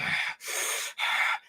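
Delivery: voiceless, huffing breath; imitated heavy breathing. Fan imitating evil Anakin staring at Obi-Wan, heavy breaths. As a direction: breathe hard like a menacing villain.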